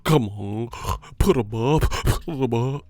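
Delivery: mock man-fan voice with huffy breaths; man fans impression. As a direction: do a huffing, whiny heckler. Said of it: "that's how these man fans be sounding".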